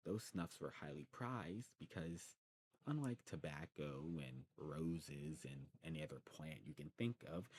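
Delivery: ordinary talk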